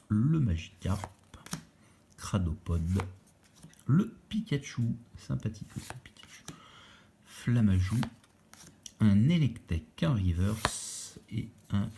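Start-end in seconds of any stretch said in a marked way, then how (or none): 5.2-6.4 whispering. Real, not acted. Whispered card names.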